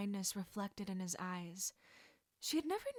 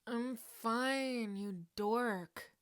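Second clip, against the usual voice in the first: groaning; 2 semitones higher, 3 dB louder, much slower than usual. Acted. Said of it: "Sirius groaned".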